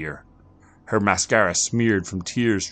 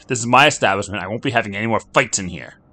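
louder, higher bartender shout; 5 semitones higher, 8 dB louder, faster than usual. Acted. Bartender stopping the fight, louder shout. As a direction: yell to break it up. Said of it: "shouted the bartender".

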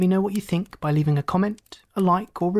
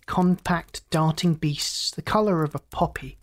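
ordinary delivery, nothing marked

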